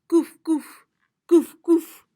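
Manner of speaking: playful dog bark. Shown as a demonstration. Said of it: "a Greek dog barks".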